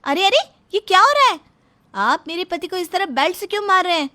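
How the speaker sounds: high, loud cry of alarm; alarmed shout. Acted. Wife rushing in to stop father-in-law beating her husband, alarmed shout. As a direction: burst in crying out to stop it.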